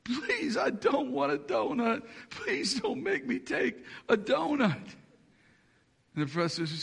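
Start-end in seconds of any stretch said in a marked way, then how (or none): 0.0-4.8 put-on girl begging not to take the donut, tearful; sobbing plea. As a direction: beg through tears like a crying girl. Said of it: "she starts kind of sobbing out".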